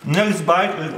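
usual speaking voice

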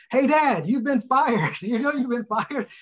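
high-pitched imitation of sons yelling; retold yelling. Sons yelling to dad from the other room, mock yell. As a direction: call out excitedly like a kid across the house. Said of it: "yelling out loud".

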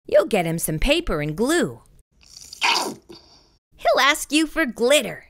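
plain reading voice

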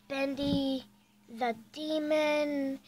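drawn-out, loud villain announcement; demon name announced. Pretend. Kid introducing her pretend demon, loud and slow. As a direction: boom out the demon's name slowly.

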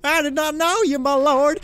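loud, high-pitched wail; crying out. Acted. Rich novice pleading with the builder, high loud wail. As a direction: wail your excuse up high. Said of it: "the rich novice cried".

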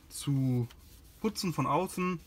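normal talking voice

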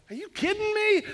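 high, astonished cry of disbelief; amazed disbelief. Retold or imitated. Pastor retelling the aquarium, amazed disbelief. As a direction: gasp it out, truly floored. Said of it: "I'm standing there going".

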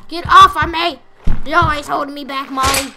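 loud angry shout at Molly; angry yell. Pretend. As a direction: shove her off, yelling. Voicing the character throwing Molly.

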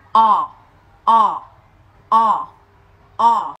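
"O" as long open English ah, mouth dropped tall; long open vowel. Shown as a demonstration. Teacher shows the correct English vowel, "o" long and open. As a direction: draw out "o" with a vertical mouth, unlike Vietnamese a. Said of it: "âm này miệng phải di chuyển theo chiều dọc cơ".

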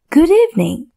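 put-on a high, boyish little prince voice; little prince greeting.

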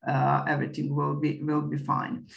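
ordinary delivery

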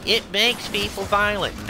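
high, sneering parody of the critics; mocking quote. Ranting streamer mocks the anti-gaming critics, high and sneering. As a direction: mimic the anti-gaming crowd with a scoff. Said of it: "fucking harp on gaming saying".

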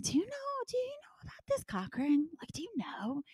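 to mommy friends at music class, hushed and secretive; conspiratorial whisper. Retold or imitated. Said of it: "And I'd be like".

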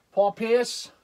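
put-on Boston accent, R's dropped. Back to the Celtics player, mock Boston accent. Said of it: "you can't say your R's".

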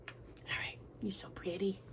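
soft whisper to the dog; hushed whisper. Real, not acted. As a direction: whisper sweetly to the pet.

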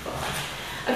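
slow guided breath count. Shown as a demonstration. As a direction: count the breaths calmly and slowly. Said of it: "So we'll do it together".

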